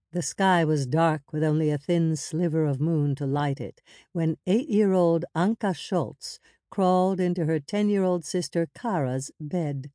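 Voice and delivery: plain reading voice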